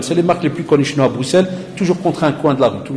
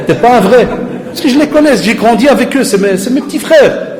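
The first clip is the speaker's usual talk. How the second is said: laughing; 9 semitones higher, 5 dB louder than usual. Real, not acted.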